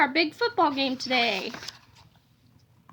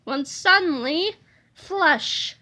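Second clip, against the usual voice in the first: usual speaking voice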